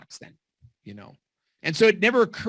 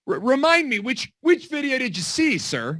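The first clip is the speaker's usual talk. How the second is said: a light high polite-clerk voice; hint of a hammed-up customer service voice; mock professional; 10 semitones higher than usual. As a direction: play the overly polite salesman. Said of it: "consummate professional".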